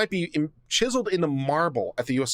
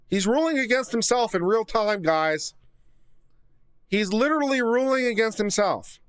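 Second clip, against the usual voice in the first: excited disbelief; 6 semitones higher than usual. Real, not acted. Gleeful reaction to ruling.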